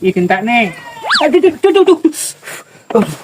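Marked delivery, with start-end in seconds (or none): 1.2-2.0 sharp high ouch, ouch; yelping from a bite; pained yelps. Real, not acted. Finger bitten while feeding.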